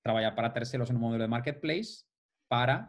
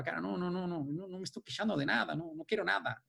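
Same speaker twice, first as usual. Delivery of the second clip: higher, softer, hasty denial; meek protest; 5 semitones higher, 6 dB quieter, faster than usual. Retold or imitated. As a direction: back off meekly, eager to please. Retold meek reply to regulator.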